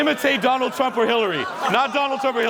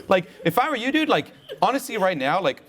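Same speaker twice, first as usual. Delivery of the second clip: normal talking voice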